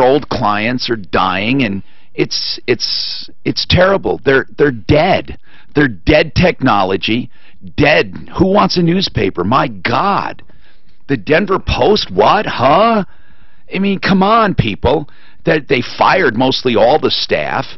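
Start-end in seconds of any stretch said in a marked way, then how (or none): none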